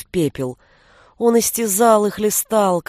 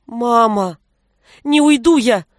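exclaiming; 2 semitones higher, 5 dB louder than usual. A narrator's reading. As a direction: cry out, refusing to leave. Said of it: "воскликнула Горица".

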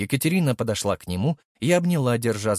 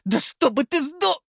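крик Зинаиды. A narrator's reading. Зинаида кричит, её прерывают.

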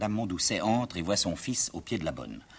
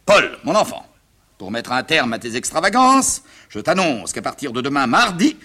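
pompous stern father; 6 semitones higher, 9 dB louder than usual. Acted. Plays father giving his verdict.

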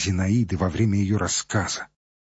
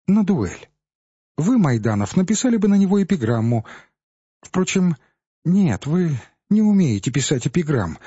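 a lightly voiced young woman, playful and higher; Zinaida's teasing voice; 7 semitones higher, 4 dB louder than usual. A narrator's reading. Zinaida teasing her admirers one by one, playful. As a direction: tease each suitor in turn, a touch mocking.

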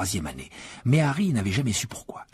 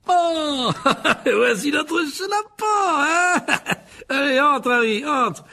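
much higher, loud, laughing showman; Lockhart; 5 dB louder, much slower than usual. A narrator's reading. Lockhart welcoming Harry to detention, loud and beaming. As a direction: gush with fake delight.

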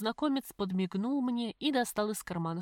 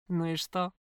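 mocking; 2 semitones lower, 3 dB louder than usual. A narrator's reading. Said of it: "Голос незнакомца звучал по-прежнему насмешливо".

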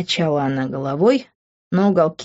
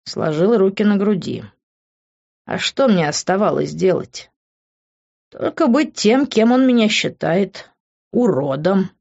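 usual speaking voice

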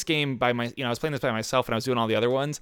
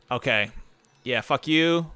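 normal talking voice

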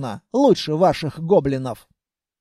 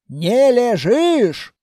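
slow, higher-pitched scolding cry; scolding shout; 4 semitones higher, 4 dB louder, much slower than usual. Acted. Grandmother catches her patient out of bed, scolding loudly. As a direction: draw out an indignant accusation.

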